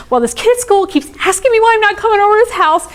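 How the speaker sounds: put-on high, rushed little girl voice; worked-up kid pouring out a complaint; upset six-year-old. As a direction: imitate a child venting in a hurry. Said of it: "And she's like".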